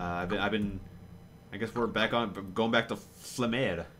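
ordinary delivery

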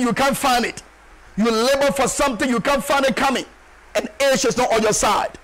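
ordinary delivery

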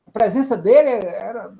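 ordinary delivery

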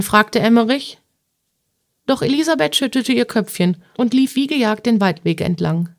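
usual speaking voice

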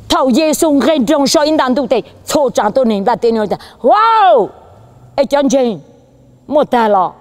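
high-pitched, ringing preacher voice; fervent preaching. Real, not acted. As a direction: preach with rising force, peaking on a loud exclamation.

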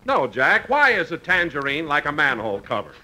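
usual speaking voice

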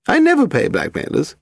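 put-on high, lofty elderly lady; grand old woman. A narrator's reading. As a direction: declare it like a grand dowager. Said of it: "Lady Delamore said grandly".

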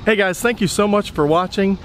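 usual speaking voice

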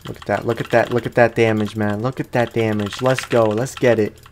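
usual speaking voice

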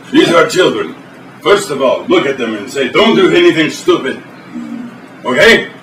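broken-English Russian host, deadpan; mock Eastern European TV host; fake Russian accent. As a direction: play a stern Russian cook in broken English. Boris cooking show sketch.

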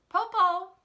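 high, loud call. A narrator's reading. Shang calls to Popo from the tree, calling out. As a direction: call down from a treetop. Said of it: "Shang called out".

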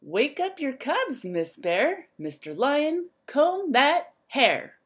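lively, sing-song storybook voice; reading to children. A narrator's reading. Teacher reading a rhyme book to the class, lively and sing-song. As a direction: wake the zoo up like a bright morning call.

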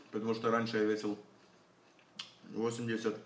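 eating between words; talking while chewing. Real, not acted. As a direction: mouth partly full of salad. Seen 1: Chews while talking, cheeks working, forking salad from the bowl.

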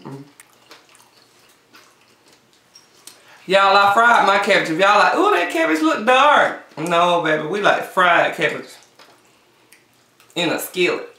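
eating between words; talking while chewing. Real, not acted. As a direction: mouth partly full. Seen 0: Woman eats cabbage throughout; mouth moves with food while speaking.

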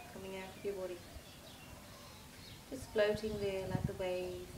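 soft, hushed meditation voice with long pauses; guided meditation. Acted. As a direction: lull them gently through a body relaxation.

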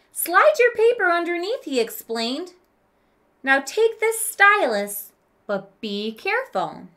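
lively read-aloud for a class; expressive story narration. Acted. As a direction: read the story to the children with character. Teacher reads story to class.

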